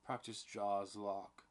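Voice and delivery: usual speaking voice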